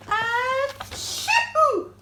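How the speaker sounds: loud, high acted sneeze; big sneeze. A narrator's reading. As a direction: let out a huge story-bear sneeze. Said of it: "he blows and he sneezes".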